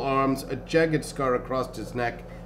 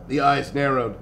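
usual speaking voice

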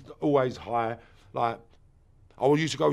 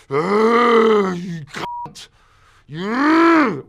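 furious outburst; 7 semitones higher, 9 dB louder, much slower than usual. Retold or imitated. Rage at his father.

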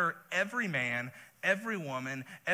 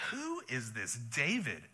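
slow, softer, dismissive sneer; scornful Nabal; 5 semitones lower, 6 dB quieter, slower than usual. Acted. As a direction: brush David off as nobody.

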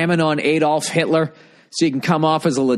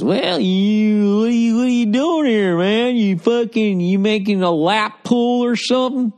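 higher, put-on voice of a prying contractor; nosy contractor; 8 semitones higher than usual. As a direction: play the suspicious backhoe guy, grilling him. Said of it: "He's gonna be asking all kinds of questions".

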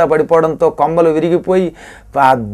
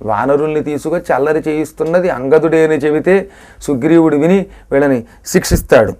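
ordinary talk

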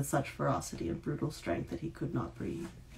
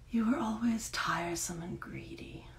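sighing; 6 semitones higher than usual. A narrator's reading. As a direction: breathe it out on a tired sigh. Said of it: "Oubliette sighed".

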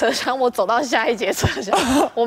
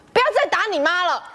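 higher-pitched mock yell across the train; retold shout; 7 semitones higher than usual. Friend on the train pranks him: higher mock yell. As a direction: call it out at him as the doors close. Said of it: "他就回头大喊说".

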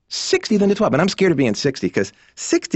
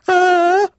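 short high yelp of panic, louder; a comic yelp of terror, much higher; mock panicked scream; 4 dB louder than usual. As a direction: shriek as the car spins out. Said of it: "are you gonna go".

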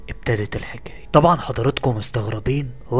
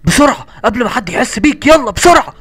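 urgent; 10 semitones higher, 10 dB louder than usual. Acted. Urgent hushed rush inside.

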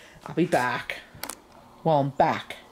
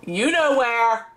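raised voice calling upstairs; yelling across the house; 5 semitones higher, slower than usual. Real, not acted. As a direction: call up to him firmly. Calling son from downstairs.

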